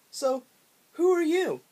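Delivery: high, loud cartoon bobcat voice; obnoxious Bubsy voice. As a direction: ham up an obnoxious cartoon cat. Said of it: "had the most obnoxious voice I've ever heard".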